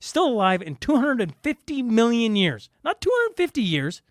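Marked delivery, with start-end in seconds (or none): 2.8-4.0 exasperated. Real, not acted. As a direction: hammer the absurd number in disbelief. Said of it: "I'm so angry".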